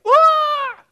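high shrieking cartoon yelp; a hammed-up freak-out scream; mock panic scream. God pictured freaking out, a high hammed-up scream. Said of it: "he's never like".

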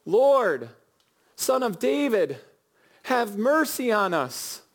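high, pleading cry for mercy; shouted plea. Retold or imitated. Blind men crying out to Jesus over the crowd, pleading shout. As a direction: beg him to hear you over the crowd. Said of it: "they shout".

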